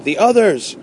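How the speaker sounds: high-pitched exclamation of alarm; urgent cry. A narrator's reading. Warning of the other fighting machines, urgent cry. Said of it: "he cried".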